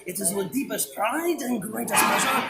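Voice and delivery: sing-song Lumiere welcome; French accent. Pretend. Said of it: "I feel like I'm watching Disney Plus".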